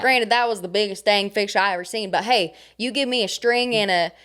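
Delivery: folksy country bragging; country accent. Pretend. Bragging to co-host about fishing, country accent. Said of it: "I can do a country accent".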